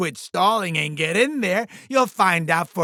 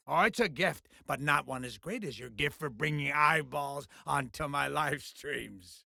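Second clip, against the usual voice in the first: low, soft, self-satisfied flattery; smug modesty; 5 semitones lower, 11 dB quieter than usual. Acted. Boss flatters his star over live streams, smug modesty. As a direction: sink into a quiet, smug purr.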